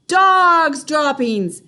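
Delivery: high, loud and slow; witches' slow loud cry; shouted. A narrator's reading. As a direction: roar it like the whole room. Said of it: "they shouted".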